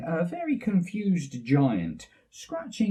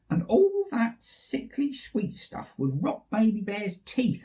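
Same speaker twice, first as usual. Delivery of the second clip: Mummy Bear; 7 semitones higher than usual. Acted. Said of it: "added Mummy Bear".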